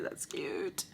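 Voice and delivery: soft unvoiced whisper. Real, not acted. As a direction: whisper it admiringly.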